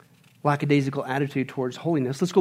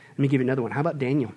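ordinary talk, nothing marked

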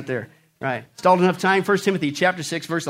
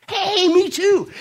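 high, drawn-out excited cry; mock-thrilled, slow and pitched way up; hammed-up delight; 12 semitones higher, 4 dB louder, much slower than usual. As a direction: stretch it out with comic over-eagerness. Joke character finds common ground.

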